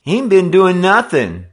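high, loud whine; whining. A narrator's reading. Simpson to the gang leader, loud high whine. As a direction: whine your way out of blame. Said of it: "Simpson whined".

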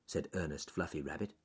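usual speaking voice

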